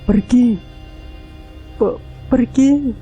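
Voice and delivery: high, shaking, terrified cry; trembling scream. Acted. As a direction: scream it out, voice breaking with fear. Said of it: "dengan suara yang gemetar".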